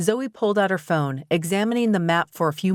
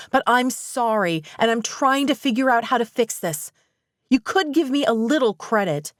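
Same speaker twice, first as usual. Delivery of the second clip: angry outburst; 5 semitones higher than usual. A narrator's reading. Zoe snaps back at Mitch.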